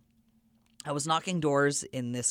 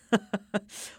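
laugh; 8 semitones higher than usual. Real, not acted. Laugh tag alone.

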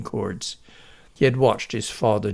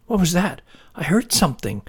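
female voice; 6 semitones higher, faster than usual. Acted. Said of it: "said a female voice just outside".

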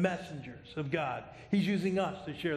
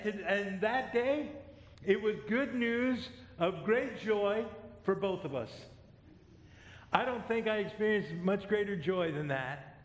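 ordinary talk, nothing marked

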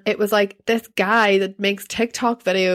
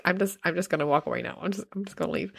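much softer, muttered excuse to leave; muted retreat; 9 dB quieter, faster than usual. Retold or imitated. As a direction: trail away quietly as if edging off. Retold polite escape from stranger.

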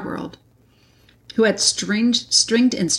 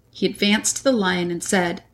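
ordinary delivery

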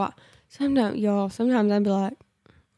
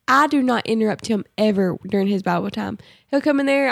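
usual speaking voice

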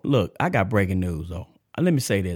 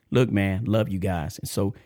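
usual speaking voice

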